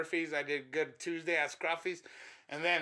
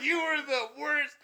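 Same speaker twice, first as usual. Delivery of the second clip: imitating the girl; 10 semitones higher than usual. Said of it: "She's like".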